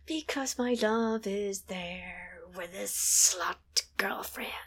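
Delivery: slow, hushed bitter mutter; under her breath. Retold or imitated. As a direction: spit it out quietly, half-whispered. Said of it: "under her breath".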